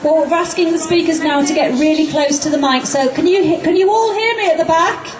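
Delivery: ordinary talk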